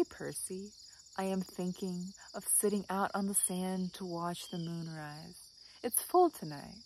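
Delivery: soft, measured recital falling away at line ends; poem reading. Acted. Vlogger reads the dog Percy's advice, soft poem recital. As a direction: recite it gently, pausing after each image.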